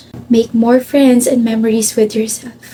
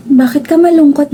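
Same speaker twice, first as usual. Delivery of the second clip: usual speaking voice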